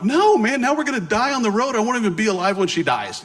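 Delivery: high, loud mock-panicked cry; hammed-up panic, laughing through it; panicked protest. Telling the missionary not to turn around, mock-panicked high cry.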